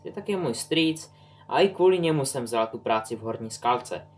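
usual speaking voice

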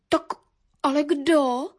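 halting, puzzled little sparrow; taken aback. A narrator's reading. Little sparrow asks who did it, taken aback. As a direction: ask hesitantly, with a gap. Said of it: "ptal se zaraženě vrabčáček".

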